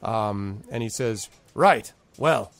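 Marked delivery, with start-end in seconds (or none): 1.5-2.5 lightly voiced brisk rider, higher and louder; mildly impatient, getting to business; businesslike rider. Mysterious rider turns to his errand, lightly voiced businesslike.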